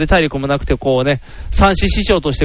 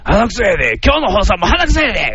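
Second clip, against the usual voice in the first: raspy singer impression; 4 semitones higher than usual. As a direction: croak it like a gravel-voiced singer. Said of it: "[name]的な".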